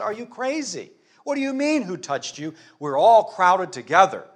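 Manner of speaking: high-pitched disbelieving retort; baffled, as if the question is absurd; incredulous. Acted. Disciples answering Jesus's question in the crowd, incredulous.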